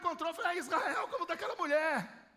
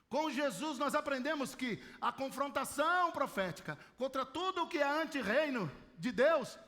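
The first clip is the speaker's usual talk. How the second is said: usual speaking voice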